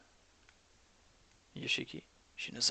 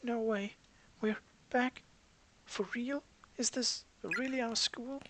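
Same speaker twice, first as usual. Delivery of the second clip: put-on higher, disbelieving girl voice; can't believe she's home; amazed schoolgirl; 11 semitones higher than usual.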